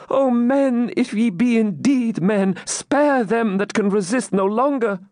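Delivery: high-pitched, fervent entreaty; anguished plea. Acted. Maiden begs attackers to spare the beaten, pleading. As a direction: implore them high, with acted distress.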